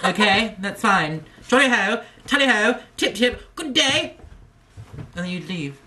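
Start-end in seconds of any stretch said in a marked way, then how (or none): 0.0-4.1 posh English. Pretend. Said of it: "You'd just be like".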